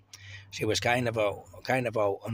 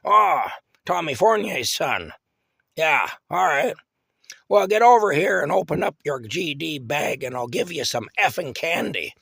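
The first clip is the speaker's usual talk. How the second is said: put-on gruff old lady; 6 semitones higher, 8 dB louder than usual. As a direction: growl it out like a crabby granny. Aunt Sarah voiced in story.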